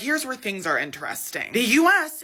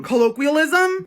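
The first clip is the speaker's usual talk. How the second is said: incredulous repeat; 5 semitones higher, 7 dB louder than usual. Real, not acted. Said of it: "Okay, colloquialism".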